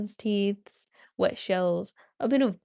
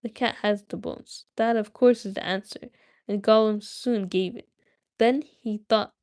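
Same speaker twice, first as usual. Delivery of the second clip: ordinary delivery, nothing marked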